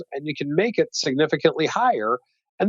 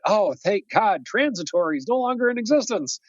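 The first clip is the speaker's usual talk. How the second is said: higher-pitched sigh of relief, lightly acted; a touch of put-on relief; mock relief; 6 semitones higher than usual. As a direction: play the relieved market, voice lifted. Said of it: "relieve the stock market for a little bit".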